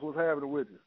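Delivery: ordinary talk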